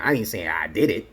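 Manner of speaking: usual speaking voice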